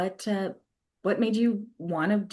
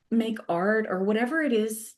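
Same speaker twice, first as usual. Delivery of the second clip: normal talking voice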